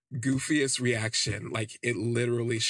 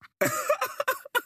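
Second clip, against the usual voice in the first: high shrieking laugh; 4 dB louder than usual. Real, not acted. Reacting to shocking gossip.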